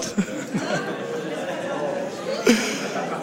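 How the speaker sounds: breathless silent laugh at his own joke. Real, not acted. Preacher laughing at his own Franconian God joke. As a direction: let the laugh out after the punchline.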